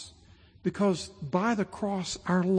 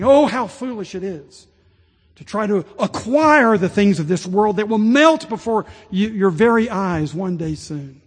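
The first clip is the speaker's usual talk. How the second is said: impassioned plea, much louder and higher; pleading bishop; 5 semitones higher, 6 dB louder than usual. Retold or imitated. Bishop Ryle pleading with his congregation, loud and impassioned. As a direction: beg the congregation with full force. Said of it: "He was pleading with this congregation".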